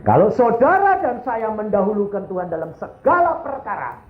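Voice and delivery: high-pitched, ringing exhortation; impassioned sermon climax; fervent preaching. Real, not acted. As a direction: proclaim it to the congregation.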